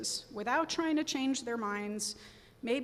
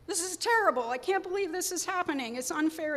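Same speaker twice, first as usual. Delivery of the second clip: higher, louder anguished outburst; distressed reaction; 7 semitones higher, 4 dB louder than usual. Retold or imitated. Speaker acts out the emotionally reactive mind, higher and louder in distress. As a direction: spill out the panic as if it's all falling apart. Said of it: "emotional reactivity".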